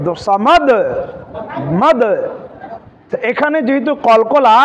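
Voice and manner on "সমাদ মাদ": সমাদ and মাদ with a bouncing final d; qalqalah. Shown as a demonstration. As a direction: echo the d at the end of মাদ. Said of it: "একটু repeat হয়ে গেল". (সমাদ, মাদ-এর দালে কলকলা)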